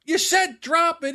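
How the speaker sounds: high, slow protest acted out; mock arguing yell. Son defending himself to his father, high indignant yell. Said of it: "he's yelling back and forth".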